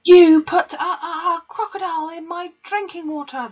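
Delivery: very high, very loud strangled yell. A narrator's reading. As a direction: explode with outrage. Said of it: "the Trunchbull yelled back".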